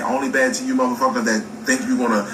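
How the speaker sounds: usual speaking voice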